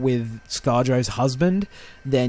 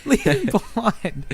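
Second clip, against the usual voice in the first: high laughing mock scream; screaming at the screen; 10 semitones higher, slower than usual. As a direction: yell at the characters, laughing. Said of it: "I was screaming at the characters".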